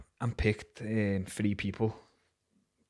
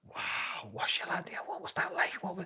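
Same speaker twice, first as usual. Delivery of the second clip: mock whisper; 8 semitones higher, 6 dB quieter than usual. Voicing Rich's eager questions.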